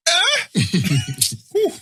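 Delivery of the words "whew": ordinary delivery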